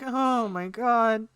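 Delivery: high, drawn-out swoon; acted overwhelmed awe. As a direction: stretch it out high, mock-swooning. Reaction to her invitation, acted out.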